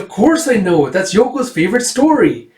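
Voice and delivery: put-on high, eager girl's voice; lifted, pleased little-girl voice; voiced young girl. Erica answers eagerly in retelling.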